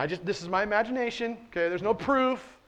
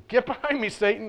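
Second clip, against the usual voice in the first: higher, stern and direct command; forceful rebuke; 5 semitones higher than usual. Shown as a demonstration. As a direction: bark it as a firm order. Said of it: "I think it was".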